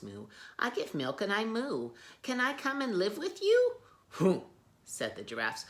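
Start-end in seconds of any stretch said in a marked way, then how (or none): 0.6-3.8 much higher, timid cow. Acted. Miss Moo asks. 4.1-4.4 loud dismissive snort; loud scoffing hoo. Acted. Giraffes scoff.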